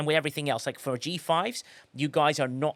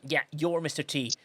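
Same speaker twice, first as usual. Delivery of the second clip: ordinary talk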